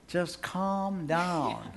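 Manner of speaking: slow, quiet imitation of a little one; imitating granddaughter. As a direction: stretch each word out slowly. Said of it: "Like our granddaughter".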